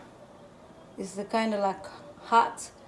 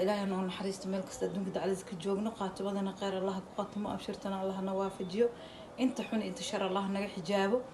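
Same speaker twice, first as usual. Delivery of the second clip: fast, softer supplication; prayer recitation; 5 dB quieter, much faster than usual. Acted. Cook praying for her viewers, rapid soft supplication.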